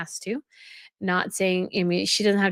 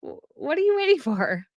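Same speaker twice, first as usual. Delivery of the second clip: mock exasperated reply; 9 semitones higher than usual. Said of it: "I was like".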